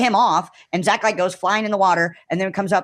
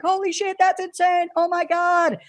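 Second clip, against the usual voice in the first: much higher, stunned excitement; amazed exclaim; 12 semitones higher than usual. Retold or imitated. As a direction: gasp at Zach riding the shark. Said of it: "we're all like".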